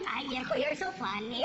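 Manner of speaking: lightly voiced mom. Mom voiced, Spanish words.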